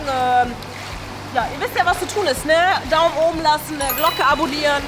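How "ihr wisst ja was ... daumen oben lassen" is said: ordinary talk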